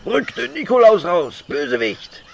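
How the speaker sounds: theatrical hero calling out the villain; heroic challenge. Acted.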